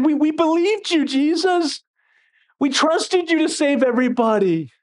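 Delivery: high-pitched, disappointed plea; let-down protest. Acted. Disillusioned believer to Jesus, pleading protest. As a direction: protest it high, feeling betrayed.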